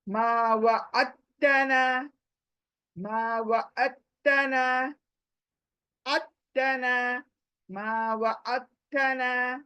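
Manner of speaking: मा व अत्तना recited slowly, jumping from आइन straight onto the doubled त; slow recitation. Shown as a demonstration. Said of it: "आइन से त के पर जम होंगे हम".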